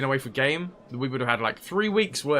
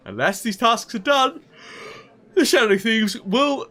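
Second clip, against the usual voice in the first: reading through a real yawn; higher voice stretched by a creeping yawn; 7 semitones higher, 3 dB louder than usual. Real, not acted. Streamer reading the quest aloud, yawning. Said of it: "why am I yawning".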